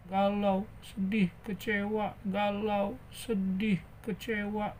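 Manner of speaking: subdued mock sadness; acted moping. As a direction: mope gently, word by word. Acts a mopey, disappointed character.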